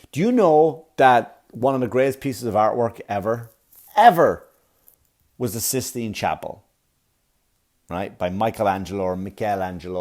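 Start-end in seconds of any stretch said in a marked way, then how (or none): none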